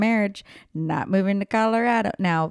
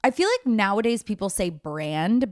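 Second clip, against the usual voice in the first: normal talking voice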